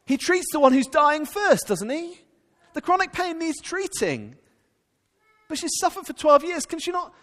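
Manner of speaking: high, loud, urgent pulpit delivery; impassioned preaching. Acted. Preacher pleading with the congregation about Jesus, loud and urgent. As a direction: press the point hard to the congregation.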